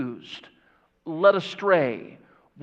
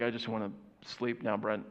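mock sleepy congregant; 7 semitones lower, 11 dB quieter than usual.

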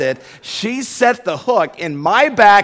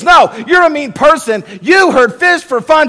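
wife accusing; 9 semitones higher, 9 dB louder than usual. Retold or imitated. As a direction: shout it at him like a scolding wife. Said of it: "She goes".

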